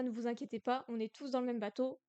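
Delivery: normal talking voice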